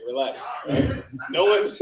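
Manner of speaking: ordinary talk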